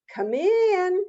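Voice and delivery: high, loud sing-out to a visitor; welcoming holler, pitched high; calling out. Acted. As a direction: call them in from across the house. Possum calls to visitor.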